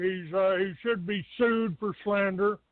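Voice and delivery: usual speaking voice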